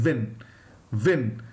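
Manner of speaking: "win" with rounded lips, not like vin; w without biting. Shown as a demonstration. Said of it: "So it's win".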